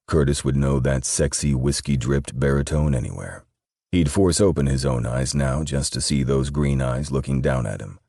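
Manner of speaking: ordinary delivery, nothing marked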